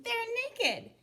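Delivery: squeaky high giggly reply; giggling. Acted.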